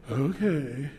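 a low, grudging "Okay." said without effort; unwilling okay. Shown as a demonstration. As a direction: drag out a reluctant "Okay.". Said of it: "never say that like that with my dad".

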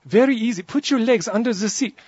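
put-on high, pressing French accent; insistent French accent. French steward keeps pressing the tall passenger, insistent French accent. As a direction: press the point in a sing-song French accent. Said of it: "So he keeps pressing me".

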